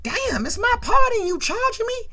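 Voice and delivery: high, indignant mock protest. Junior objects to paying for his party, indignant.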